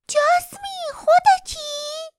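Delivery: put-on high, slow, young girl's voice; young sweet voice. A narrator's reading. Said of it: "صدایی جوان، شیرین و گرم".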